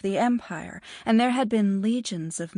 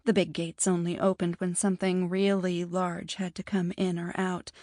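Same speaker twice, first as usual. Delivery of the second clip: ordinary delivery, nothing marked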